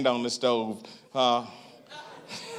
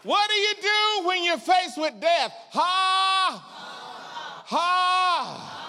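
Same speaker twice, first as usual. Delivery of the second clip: high, drawn-out preacher's cry; shouted preaching; much slower than usual. Real, not acted. Preacher challenging congregation facing death, high shout. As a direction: shout the challenge, then long "ha"s.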